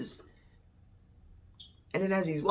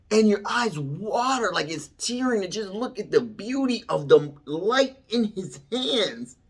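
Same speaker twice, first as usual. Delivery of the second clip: high, raised, marvelling voice; overcome with wonder; 10 semitones higher, 5 dB louder than usual. Real, not acted. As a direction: pour out awe at a higher pitch. Overcome by beauty of the light.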